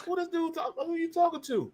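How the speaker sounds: very high, hushed incredulous squeak; retold disbelief. Rookie wondering who the veteran is talking to, high hushed disbelief. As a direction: react in a small high voice.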